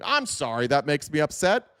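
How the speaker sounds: ordinary talk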